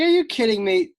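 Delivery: high, loud disbelieving outburst; incredulous. Retold or imitated. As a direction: fire it off in shocked disbelief. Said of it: "And I was like".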